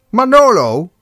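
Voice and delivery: loud, high call of the name; the director bellows for Manolo. A narrator's reading. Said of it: "riep de dikke deur". (directeur roept luid en hoog Manolo)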